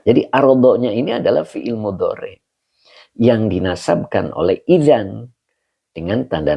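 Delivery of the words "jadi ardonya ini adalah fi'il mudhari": ordinary talk, nothing marked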